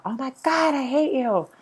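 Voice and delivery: high, loud mock hate outburst. Snapping at her ex in a moment of anger, mock outburst. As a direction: blurt it out in a flash of temper. Flash of anger, then retracted.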